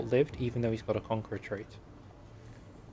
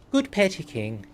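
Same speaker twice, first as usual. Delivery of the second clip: attempted Welsh accent; 5 semitones higher, 4 dB louder, slower than usual. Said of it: "I've turned Irish".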